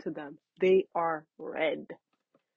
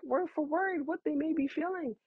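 ordinary delivery

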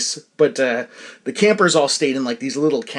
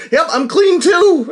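mock innocent; 14 semitones higher, 7 dB louder, slower than usual. Kid lying about showering.

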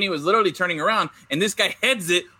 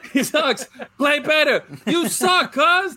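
much higher, louder laughing taunt; hammed-up trash talk; 9 semitones higher, 4 dB louder, slower than usual. Host hamming up Sabathia's line for cohosts, laughing high taunt. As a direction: squeal the insult for laughs.

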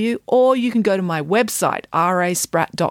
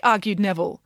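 plain reading voice

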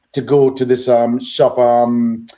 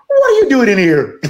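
high-pitched imitation of an indignant shopkeeper; mock indignant shopkeeper; indignant challenge; 9 semitones higher than usual. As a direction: challenge an intruder, pitched well up. Shopkeeper confronts him in retold story.

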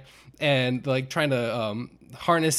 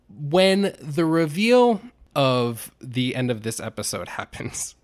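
ordinary delivery

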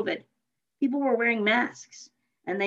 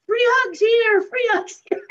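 loud barker's call, much higher; hawking the crowd; 14 semitones higher, 6 dB louder than usual. Retold or imitated. Son hawking free hugs to the crowd, calling out loud and high. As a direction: call it out like a street vendor.

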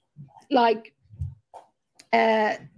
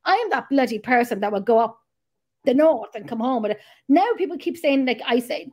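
ordinary talk, nothing marked

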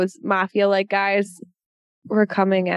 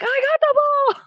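high mock triumph. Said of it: "he's like".

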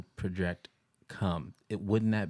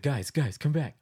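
higher, urgent call; 6 semitones higher, 4 dB louder than usual. Retold or imitated. Dreamer calls bandmates back to the creepy dummy, calling out. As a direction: call your friends back over.

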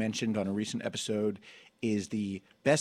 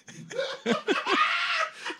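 shrieking laugh. Real, not acted. Laughing at the review's greeting.

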